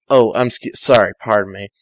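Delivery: plain reading voice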